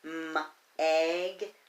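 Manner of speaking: M-egg split into m and egg; sounding out. Shown as a demonstration. Teacher tapping out Meg with the class. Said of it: "Let's tap it out".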